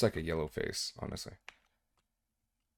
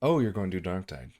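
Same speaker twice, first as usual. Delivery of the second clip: usual speaking voice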